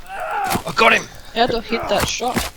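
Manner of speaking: high triumphant yell; excited shout. Real, not acted. Hits rabbit with arrow.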